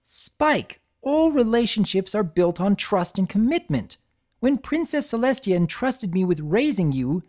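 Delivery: a mentor laying down the law; Twilight, stern lecture. Acted. Twilight lecturing Spike on trust, stern.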